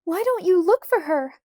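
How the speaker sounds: put-on high, loud, frantic child; crying out. A narrator's reading. Carrie begs Laura to search, crying out frantically. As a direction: demand it in distress. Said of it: "Carrie cried".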